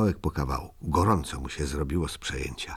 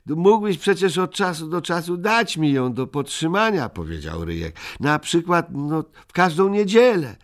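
high, coaxing little voice; pleading; 11 semitones higher, 3 dB louder than usual. Acted. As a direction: beg sweetly for a turn. Ryjek begging for turns.

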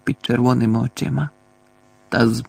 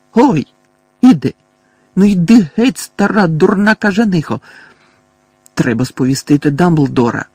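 higher, louder yelps under blows; pained yelling; 6 semitones higher, 6 dB louder than usual. A narrator's reading. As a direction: cry out, fending her off. Mundungus beaten with bag.

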